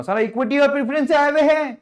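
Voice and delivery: high, loud shout of the answer; eager student shout. Shown as a demonstration. Teacher voicing an eager class answering, loud high shout. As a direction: blurt the answer like a keen student. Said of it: "तेज तेज बोलो ना".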